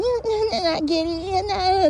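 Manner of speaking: squeaky, high-pitched brush-off; put-on high voice for a retold comeback; high-pitched mock reply. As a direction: rattle it off way up high.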